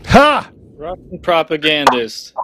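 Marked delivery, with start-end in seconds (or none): 0.1-0.5 a loud, high, single mocking bark of a laugh. Real, not acted. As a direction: blurt one scornful laugh. Host reacts to guest leaving.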